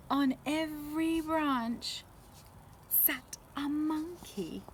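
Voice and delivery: dramatic slow reveal. A narrator's reading. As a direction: draw out the surprise. Reveal of the monkeys.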